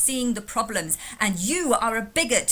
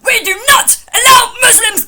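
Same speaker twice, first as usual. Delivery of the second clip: parody rant; 12 semitones higher, 15 dB louder than usual. Retold or imitated.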